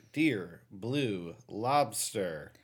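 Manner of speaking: drawn-out, dramatic title read; slow dramatic title. Pretend. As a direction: savor each word slowly.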